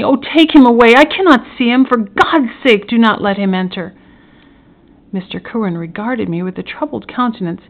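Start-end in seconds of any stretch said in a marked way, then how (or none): 0.0-3.9 loud, high-pitched desperate plea, easing at the end; agonized cry. A narrator's reading. Prisoner dreads the murderer's visit, cries out in agony. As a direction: beg in horror to be spared. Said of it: "cried out in agony".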